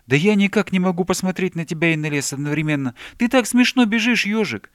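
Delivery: put-on high, loud childlike exclaiming; excited protest. A narrator's reading. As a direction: protest eagerly to a friend. Bear protests he cannot do it.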